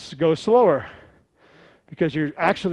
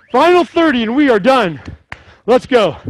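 loud, high hype; shouting; 5 semitones higher, 7 dB louder than usual. Real, not acted. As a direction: push them through the final stretch. Instructor pushes final interval.

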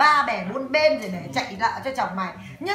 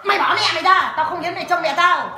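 louder, higher imitated husband's demand; retold angry demand; 5 semitones higher, 6 dB louder than usual. Husband ordering wife to fetch his mother, angry and louder. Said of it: "nó đến bảo là".

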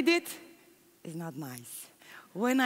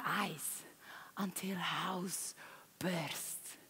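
whisper; 5 semitones lower, 12 dB quieter, much slower than usual. Real, not acted. Intimate memory, whispered.

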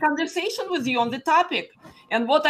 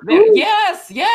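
excited; 9 semitones higher, 9 dB louder than usual. Real, not acted. Delighted at seeing video.

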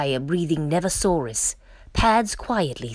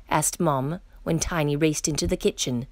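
usual speaking voice

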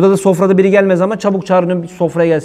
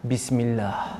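Bismillah said low and much softer; quiet Bismillah; 5 semitones lower, 12 dB quieter, much slower than usual. Shown as a demonstration. Preacher showing a timid quiet Bismillah. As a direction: mumble Bismillah under your breath. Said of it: "geç lan bu işleri".

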